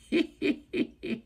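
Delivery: high cackling laugh; witch cackle. Acted. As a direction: cackle like a pleased old witch. Witch's triumph in story.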